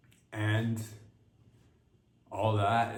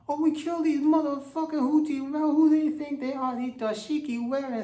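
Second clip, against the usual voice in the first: hammed-up warmonger; 12 semitones higher than usual. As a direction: bellow the threats squeaky and pumped. Repeated warmonger impression.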